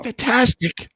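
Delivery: high-pitched, enthusiastic "fantastic"; excited retold reply. Retold eager reply to good news.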